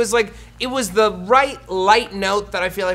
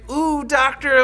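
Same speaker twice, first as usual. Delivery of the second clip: mock-smitten, pitched up; crushy swooning; 7 semitones higher, 4 dB louder, slower than usual. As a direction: imitate a lovestruck fan, lightly hammed up. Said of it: "like, crushy stuff".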